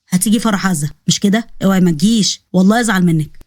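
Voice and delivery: low-voiced friendly man; Osman, coaxing. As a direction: coax her to come, pitched low.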